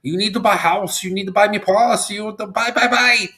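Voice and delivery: high-pitched imitation of a yelling lady; mock yelling. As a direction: nag insistently in a put-on high voice. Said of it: "the sweet lady's yelling at him".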